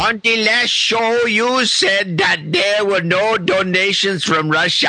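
put-on a hesitant stab at a Russian accent reading the note; half-hearted Russian accent, not quite landing. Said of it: "do my best Russian".

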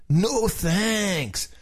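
high surfer dude refusal. Shown as a demonstration. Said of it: "the whole thing in a surfer's voice".

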